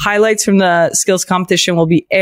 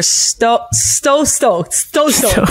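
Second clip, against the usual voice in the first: higher, tripping repeatedly on "stoked"; stumbling over STOKED; 4 semitones higher, much slower than usual. Real, not acted. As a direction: stammer "sto-, sto-" before getting "stoked" out. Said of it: "I stumble over my words".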